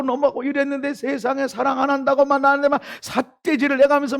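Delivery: high, loud, wronged complaint; aggrieved outburst. Retold or imitated. Husband telling the congregation his wife wronged him, aggrieved. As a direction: pour out the sense of unfairness.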